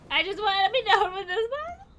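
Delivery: whiny near tears. Real, not acted. Frustrated puzzle player near tears.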